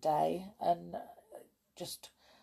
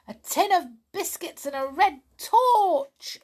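much higher, louder yell; 12 semitones higher, 7 dB louder than usual. Acted. Pipkin yelling about his presents, gleeful. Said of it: "yelled Pipkin".

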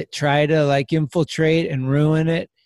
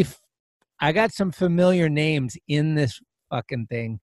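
ordinary talk

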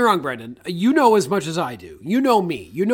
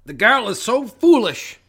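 lightly mimicked Scottish brogue; imitated Scottish line; 4 semitones higher, 5 dB louder, slower than usual. Quoting a film character's line about Joan, hammed-up Scottish accent. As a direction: quote it in a mock Scottish lilt, drawn out at first. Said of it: "and he says".

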